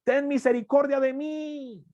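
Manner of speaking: imitating a blind beggar's cries; crying out. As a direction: call out for mercy from afar, pitch way up. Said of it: "dio voces diciendo".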